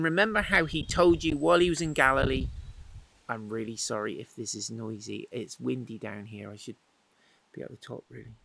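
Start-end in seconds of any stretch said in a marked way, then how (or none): none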